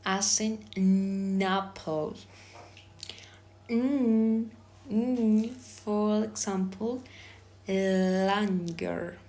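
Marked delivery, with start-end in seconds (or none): 3.7-5.5 N as a sustained hum, twice; isolated consonant sound. Shown as a demonstration. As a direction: hum N alone, then repeat n. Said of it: "I am going to pronounce".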